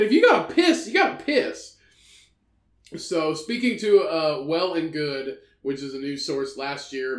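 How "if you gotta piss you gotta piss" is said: mock indignant.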